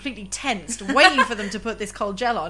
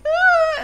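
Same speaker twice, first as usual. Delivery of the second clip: high, loud yelp; sudden squeak of fright; mock alarmed gasp; 8 dB louder than usual. Patient during long scan, mock yelp.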